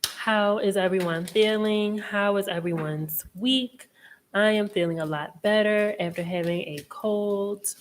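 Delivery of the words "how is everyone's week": normal talking voice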